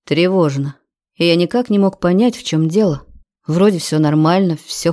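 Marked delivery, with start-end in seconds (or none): none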